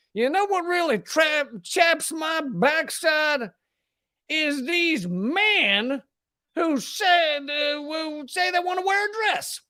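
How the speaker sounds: high, slow hammed-up Southern drawl; put-on Southern accent mocking a bigot. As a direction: drag it out like a clueless Southern bigot. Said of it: "I really shouldn't give them a Southern accent".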